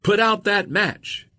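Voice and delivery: high, drawn-out shouted command; narrated shout, pitched well up; shouted order. A narrator's reading. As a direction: call it out like a private in the trenches. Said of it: "in his trench on the battlefield and shouted".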